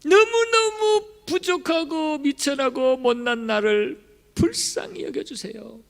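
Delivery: a lowly beggar pleading slowly for pity; humble plea. Acted. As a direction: beg for mercy from the bottom.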